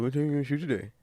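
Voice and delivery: imitated phone echo; mock echo. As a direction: parrot it back like an echo on the line. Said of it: "I just started mocking you on the phone".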